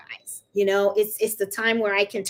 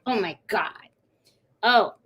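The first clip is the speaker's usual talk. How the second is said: ordinary delivery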